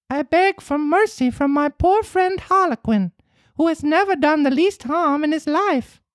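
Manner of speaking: very high puppet voice pleading; pleading boy. Acted. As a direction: plead for a friend's life. Pinocchio pleads for Harlequin.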